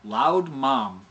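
loud mom with the closing turned into an M; loud mom, lips pressed together on the M; wrong way demo. Shown as a demonstration. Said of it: "It's not like".

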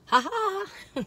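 high teasing ha ha; mocking life. Playing life mocking the viewers, high taunt. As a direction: taunt like life laughing at you. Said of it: "life's gonna say".

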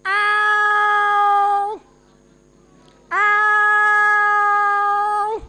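loud, high, long-held yowl; second cat's yowl. Acted. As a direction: answer like another cat with a sustained cry. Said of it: "ಇನ್ನೊಂದು ಬೆಕ್ಕು ಹೇಳ್ತಿತ್ತು".

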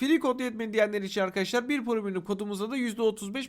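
soft, steady sponsor pitch; ad read. Acted. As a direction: read it like a quick ad.

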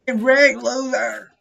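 loud, high jeering call; loud taunt. Real, not acted. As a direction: yell to mock the other player. Taunting another player.

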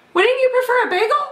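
squeaky donut. Acted. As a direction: rattle it off hopefully.

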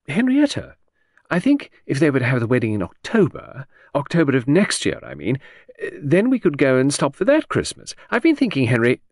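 breezy chatter that floats from topic to topic; Lucy, lady of the house. Acted. Chatting to her husband in the garden, airy high lady. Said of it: "said calmly".

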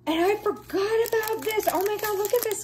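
high, bubbling excitement; showing viewers a treasure; thrilled. Real, not acted. Remembers forgotten special piece.

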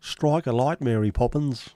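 mock a comically bad film Cockney.

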